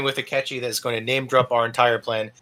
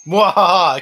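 loud mock villain cackle; evil laugh; 5 semitones higher, 6 dB louder, slower than usual.

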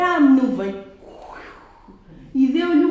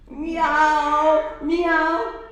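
cat meow; 3 semitones higher than usual. Shown as a demonstration. Storyteller showing children how the cat meows. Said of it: "Como é que o gato mia?".

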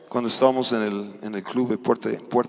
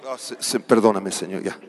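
soft, low prayer voice; hushed prayer; 3 semitones lower, 7 dB quieter than usual. Real, not acted. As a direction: drop into a whispered plea to God. Preacher moves into hushed prayer. (oración suave y baja)

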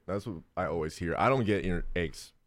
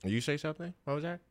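dismissive doctor; 8 semitones higher, 5 dB quieter than usual. Retold or imitated.

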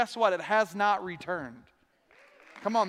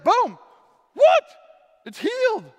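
much higher, much louder astonished shout; amazed healing cry; 13 semitones higher, 12 dB louder than usual. Pretend. The healed woman crying out in astonishment, loud and high. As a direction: burst out in disbelief at the miracle. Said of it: "for her to be like".

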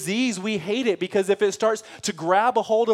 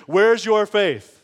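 louder, slow scolding question; harsh rebuke; 3 semitones higher, 6 dB louder, much slower than usual. Pretend. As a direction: scold them for their lack of faith. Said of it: "with a tone of like".

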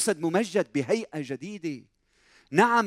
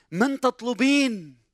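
raised, slow, drawn-out question; challenging question; 5 semitones higher, slower than usual. Acted. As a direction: press her slowly in a higher pitch. Acts out Jesus questioning Mary. (سؤال متحدٍّ بنبرة مرتفعة وبطيئة)

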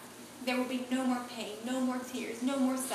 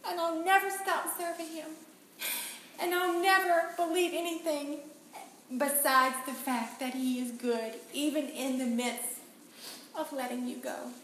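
tearful farewell to son; 6 semitones higher than usual. Retold or imitated. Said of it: "I said".

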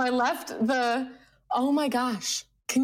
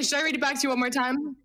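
normal talking voice

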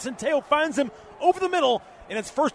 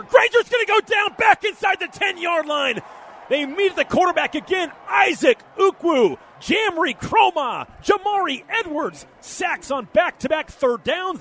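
excited; 6 semitones higher, 3 dB louder than usual. Real, not acted. Back-to-back sacks called live.

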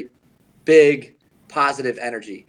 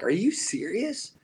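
retold embarrassed disbelief; 6 semitones higher, 7 dB quieter, slower than usual. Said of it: "embarrassed. And he's like".

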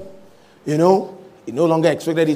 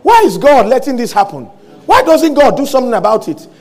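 high, loud, frantic pleading; frantic questioning; 9 semitones higher, 8 dB louder than usual. Acted. Preacher acts out Hagin questioning the blocked vision, building to frantic shouting. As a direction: escalate into frantic shouts, hammering each why. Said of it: "he actually got frantic".

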